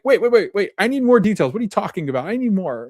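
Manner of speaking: excited, high-pitched retold demand for details; eager interrupting. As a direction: jump in high and loud, hungry for details.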